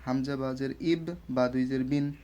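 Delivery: ordinary delivery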